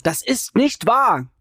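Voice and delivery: high, slow, emphatic protest; Jay denying it, shouting. A narrator's reading. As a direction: fling it out, high and drawn out. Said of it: "schreit Jay".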